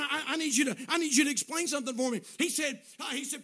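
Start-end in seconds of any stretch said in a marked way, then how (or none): 0.0-2.2 loud, stammering retold plea; frantic. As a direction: beg urgently, like a man in a panic. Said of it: "just frantic".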